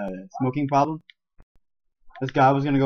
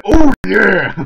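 booming mascot yell, pitched up; Kool-Aid Man; 8 semitones higher, 15 dB louder than usual. Acted. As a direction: burst in with a huge, drawn-out cheer. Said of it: "Kool-Aid, Kool-Aid man".

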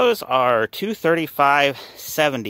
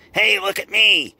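higher-pitched pretend yell for attention; mock yell; 8 semitones higher, 4 dB louder than usual. Rusty trunk yells at the owner for attention, mock yell. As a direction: give the car flaw a mildly yelling voice. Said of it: "yelling at you".

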